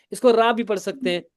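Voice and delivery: ordinary talk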